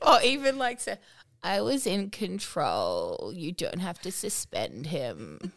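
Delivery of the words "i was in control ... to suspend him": slow, sinking vocal fry; mock Kardashian. Voicing the story's teacher, Kardashian drawl. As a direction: let each phrase creak downward. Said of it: "like the Kardashians".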